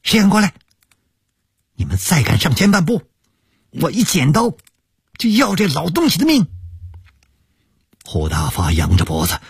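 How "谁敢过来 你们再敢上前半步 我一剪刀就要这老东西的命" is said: high, fierce threatening shout. A narrator's reading. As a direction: yell a warning at the thugs. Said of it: "冲那几个打手喊".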